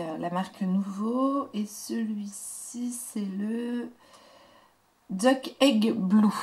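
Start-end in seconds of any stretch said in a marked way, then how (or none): 5.1-6.3 English words said slowly in a French speaker's English accent; slow, emphatic attempt at an English accent; self-mocked English accent. Real, not acted. Crafter reading out the colour name, in a self-mocked English accent. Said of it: "avec mon super accent anglais".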